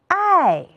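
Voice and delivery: "I" as ài, falling steeply from high; fourth-tone "I", sharp fall; tone drill. Shown as a demonstration. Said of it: "和老师一起比划着读一读吧".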